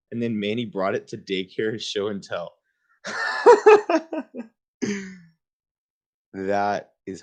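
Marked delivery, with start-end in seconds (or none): 3.0-4.5 loud squealing laugh; really cracking up; high burst of laughter. Real, not acted. Reacts to show and tell.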